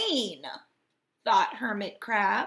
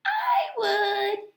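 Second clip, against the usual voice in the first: very high tiny voice; little sea star; 12 semitones higher than usual. A narrator's reading. Little sea star volunteering to the crab, very high. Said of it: "signaled a little sea star".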